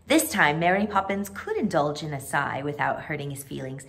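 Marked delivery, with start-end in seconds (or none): none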